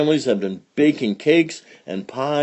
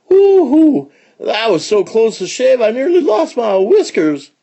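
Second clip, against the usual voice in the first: high, loud cowboy whoop; whooping excitement; 12 semitones higher, 5 dB louder than usual. Acted. Outlaw in the stagecoach after a close shave, high excited whooping.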